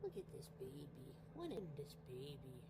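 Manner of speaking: hushed pet talk to a sleeping cat; cooing to cat. Real, not acted. Talking softly to the sleeping cat, low coo.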